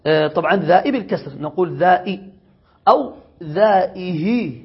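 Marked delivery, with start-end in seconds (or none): 2.9-4.5 ذائه said slowly with the final vowel drawn out; lengthened vowel. Shown as a demonstration. Teacher to students, drawing out ذائه slowly. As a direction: stretch the ending of ذائه long. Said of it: "مع الإشباع".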